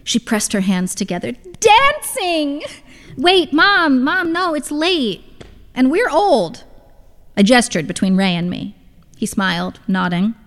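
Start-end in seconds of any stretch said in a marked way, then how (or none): 1.6-2.6 high-pitched eager exclamation; excited. A narrator's reading. Mother presses hands together proposing a night out, excited. 3.2-6.6 high-pitched objection to Mom; head her off, pitched up and pleading; pleading protest. A narrator's reading. Daughter tries to stop mother's dancing plan, pleading protest.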